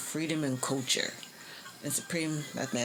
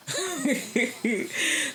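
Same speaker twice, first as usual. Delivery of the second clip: high, loud knowing laugh; 12 semitones higher, 4 dB louder than usual. Real, not acted. Vlogger laughing after a pointed remark, high laugh.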